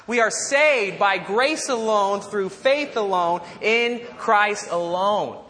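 slow, measured recitation with firm emphasis; deliberate recitation. Real, not acted. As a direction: lead a group repeat, word by word. Leads congregation in repeating it.

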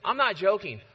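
ordinary talk

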